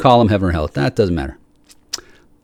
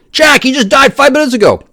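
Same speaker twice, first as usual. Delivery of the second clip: imagined messenger; 5 dB louder than usual. Pretend. Voicing someone bringing news.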